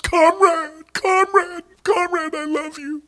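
very high, loud wailing plea; frantic fawning cry. Retold or imitated. Aide grovelling over the reviving Stalin, high frantic wail. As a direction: squeal it out, desperate to be forgiven.

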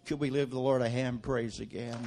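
usual speaking voice

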